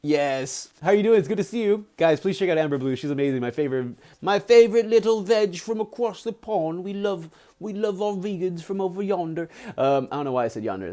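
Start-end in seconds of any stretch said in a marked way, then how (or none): 4.2-9.5 playful, badly faked British accent; attempted British accent. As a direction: put on a hammy British voice. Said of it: "That's not British".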